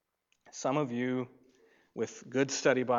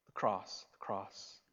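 whispered "peace" and "the cross"; 4 semitones lower, 8 dB quieter than usual. Acted. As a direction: drop to a hush. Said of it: "hushed answer".

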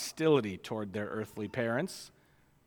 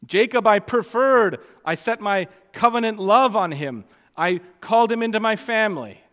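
loud, raised-pitch divine declaration; forceful preaching as God; 7 semitones higher, 10 dB louder than usual. Acted. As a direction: proclaim it from the pulpit as God speaking. Preacher paraphrases God's words forcefully.

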